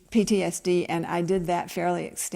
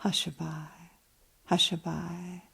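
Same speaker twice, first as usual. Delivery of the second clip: softer, hushed crooning of a lullaby; 8 dB quieter than usual. Acted. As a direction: croon it as if to a sleeping child.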